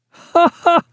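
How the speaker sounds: high spoken "ha, ha"; hearty tipsy laugh, acted. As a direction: burst out with a high, wine-flushed ha-ha. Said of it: "cried Wilkins, somewhat flushed with his wine".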